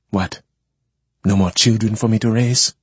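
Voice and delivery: bitter question, voice climbing at the end. A narrator's reading. Woman challenging the man who walked in, bitter. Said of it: "she said bitterly".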